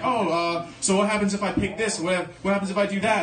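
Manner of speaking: lightly voiced players pestering the game master; players asking. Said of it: "they're like".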